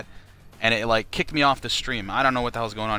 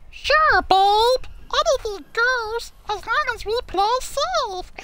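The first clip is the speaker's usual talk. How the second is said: squeaky cartoon boy voice; Bobby from Bobby's World; slower than usual. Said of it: "Bobby from Bobby's World".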